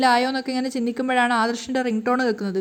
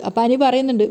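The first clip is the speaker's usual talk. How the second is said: plain reading voice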